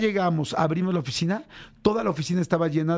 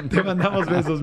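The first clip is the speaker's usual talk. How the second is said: laughing. Real, not acted.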